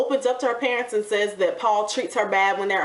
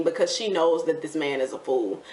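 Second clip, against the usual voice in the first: ordinary talk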